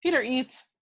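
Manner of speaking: ordinary talk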